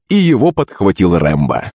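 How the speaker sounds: usual speaking voice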